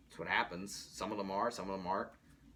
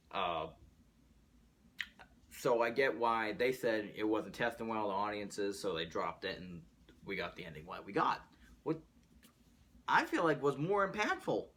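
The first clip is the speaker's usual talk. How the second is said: ordinary talk, nothing marked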